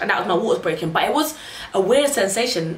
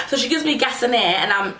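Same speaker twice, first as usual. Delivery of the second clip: ordinary talk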